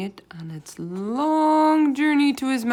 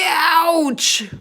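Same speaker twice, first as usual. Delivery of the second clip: high, loud giant yelp; a giant bellowing in pain; roar of pain; 12 semitones higher, 12 dB louder than usual. A narrator's reading. Bloodbottler bitten inside his mouth, roaring in pain. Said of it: "roared the blood bottler".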